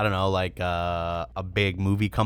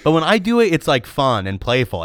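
higher, louder playful rant; mock outburst; 9 semitones higher, 6 dB louder than usual. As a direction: playfully blow up at him.

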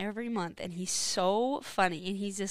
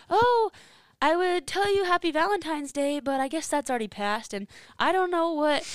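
lightly voiced old man, higher and louder; funny old man; 8 semitones higher, 5 dB louder than usual. Older customer lingering on the phone, lightly imitated old man. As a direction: imitate a chatty elderly caller, pitched up. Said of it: "talks really slow and funny".

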